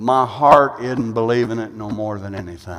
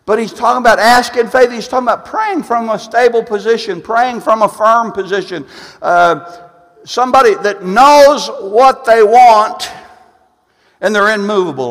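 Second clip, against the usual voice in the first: quick, high, insistent proclamation; emphatic preaching; 9 semitones higher, 8 dB louder than usual. Real, not acted. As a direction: hammer the point home, louder at the end. Preaches at full force.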